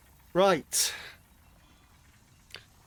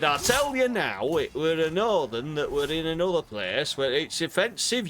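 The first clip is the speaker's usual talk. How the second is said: a playful put-on Yorkshire voice; mock Yorkshire accent; 3 semitones higher, 3 dB louder than usual.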